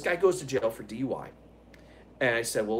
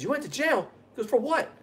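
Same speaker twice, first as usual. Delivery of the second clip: surprised; 10 semitones higher than usual. Retold or imitated. Coworker surprised at news.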